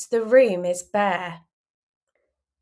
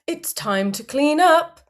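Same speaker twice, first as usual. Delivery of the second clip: calling dad; 5 semitones higher, 6 dB louder than usual. Acted. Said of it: "Daddy calls to the mess".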